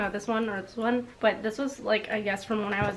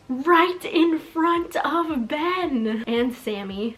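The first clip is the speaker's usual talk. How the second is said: indignant; 5 semitones higher, 3 dB louder, much slower than usual. Retold or imitated. Outraged diary line read aloud.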